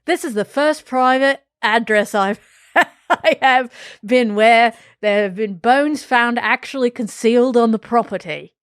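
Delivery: put-on quoting the inspector in a light Australian accent. Reading the retired inspector's quote in Australian accent. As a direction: keep the Aussie accent going, half laughing. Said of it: "Why is he Australian?".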